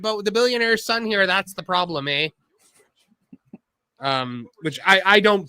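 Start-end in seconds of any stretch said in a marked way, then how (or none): 0.0-2.3 put-on Canadian accent. Mocking Martha in Canadian voice.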